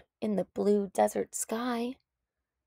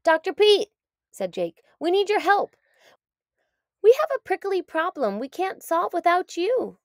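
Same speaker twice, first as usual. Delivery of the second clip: higher, louder urgent plea; pleading animals; 9 semitones higher, 6 dB louder than usual. A narrator's reading. Patients begging the tired doctor, urgent and high. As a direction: beg the doctor for help.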